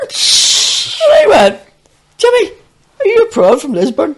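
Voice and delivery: high, loud and laughing; half-laughing quoted question. Retold or imitated. Retold question to Jimmy, laughing.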